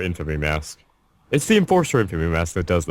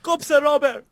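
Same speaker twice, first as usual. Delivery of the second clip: excited. Real, not acted. Excited exclamation about the game theme.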